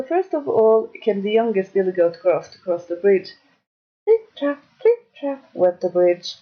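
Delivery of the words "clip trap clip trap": light, high-pitched trip-trap; tiny goat's hoofsteps. A narrator's reading. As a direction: tap out little hooves in a high voice. Smallest goat's steps on bridge.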